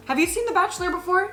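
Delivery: high, fast, eager question. Real, not acted. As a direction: ask it bright and quick. Eager host question to mom.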